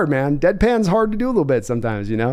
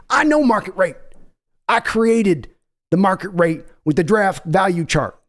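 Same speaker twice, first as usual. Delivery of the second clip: folksy Texan owner voice, pushy; brash Texas accent of a team owner; Jerry Jones impression. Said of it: "That was me, Jerry Jones".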